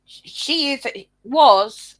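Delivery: usual speaking voice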